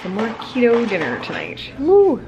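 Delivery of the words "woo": much higher, louder whoop; cheer. Real, not acted. Vlogger cheering at her dinner, loud high whoop. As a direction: whoop with delight.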